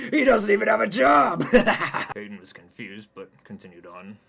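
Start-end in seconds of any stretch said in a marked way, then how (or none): 0.1-2.1 loud high laughing shout; cackling dinosaur. Acted. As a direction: laugh through the words, howling. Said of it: "points and cackles".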